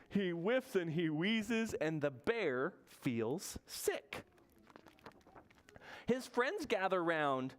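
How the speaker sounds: slow, drawn-out storybook narration; reading to children. A narrator's reading. Storyteller reads about sick Bear, slow picture-book reading. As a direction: read it slowly, stretching the key words.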